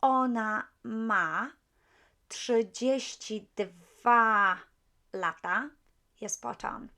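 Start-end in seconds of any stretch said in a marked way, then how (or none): 0.0-5.7 ona ma trzydzieści dwa lata spelled out very slowly; slow model answer. Shown as a demonstration. Teacher slowly modelling the Polish answer for students. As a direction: give the answer word by word. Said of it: "If you have anything like".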